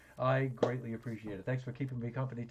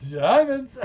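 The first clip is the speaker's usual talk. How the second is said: loud high shout of discovery; excited shout; 14 semitones higher, 18 dB louder than usual. Real, not acted. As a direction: yell it out in triumph. Finds diamonds on stream.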